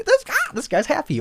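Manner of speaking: high bright dog voice; high perky dog voice; dog's thoughts. Retold or imitated. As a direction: voice the dog noticing.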